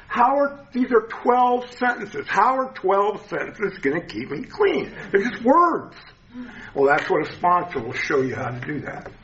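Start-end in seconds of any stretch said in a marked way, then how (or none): 0.1-6.0 incredulous. Retold or imitated. Retold doubt about the steps.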